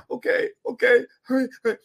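high, odd voice, repeated as a compliant patient; mock Rain Man. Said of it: "She talks to me like I'm Rain Man".

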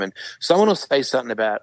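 ordinary talk, nothing marked

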